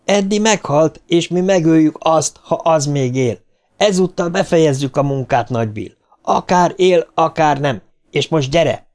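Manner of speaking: high, driving insistence; forceful urging. A narrator's reading. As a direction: push him to finish the job. Richie harshly urges Bill on.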